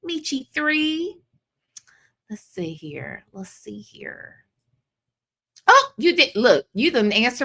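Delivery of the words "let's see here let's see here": whispered to herself while scanning the chat; a soft unvoiced murmur as she looks for something. Real, not acted. Reading comments to herself.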